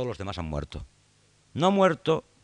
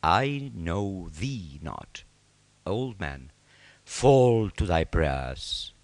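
cold royal rejection; 5 semitones lower, much slower than usual. Acted. King coldly rejects old friend.

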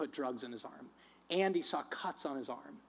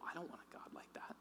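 very soft, near-whispered admission; hushed confession; 17 dB quieter, faster than usual. Retold or imitated. Preacher voices his flesh protesting to God, hushed whisper. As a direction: drop to a whisper, a little quicker.

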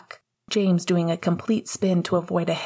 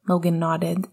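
ordinary delivery

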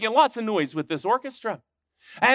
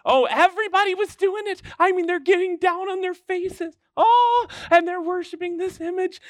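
much higher, wide-eyed storytelling; excited; 11 semitones higher than usual. A narrator's reading. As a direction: build the excitement for the kids. Excited telling of the crowd worshiping.

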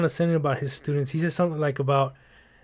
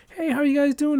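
much higher, sugary greeting; sweet old lady; 10 semitones higher than usual. Retold or imitated. Imitating the usually sweet tenant greeting the staff. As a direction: chirp it like a kindly grandma. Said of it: "she's always been sweet".

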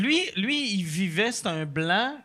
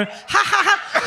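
creepy laugh; 10 semitones higher, much slower than usual. Retold or imitated. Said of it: "c'est quoi ce rire-là".

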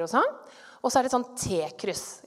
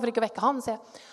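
usual speaking voice

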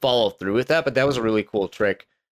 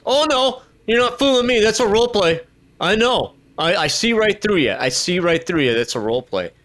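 knowing scoff; 8 semitones higher than usual. Retold or imitated. Trainee seeing through role play.

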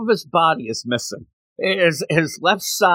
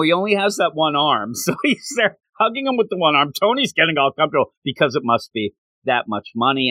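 normal talking voice

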